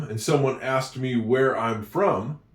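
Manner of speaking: normal talking voice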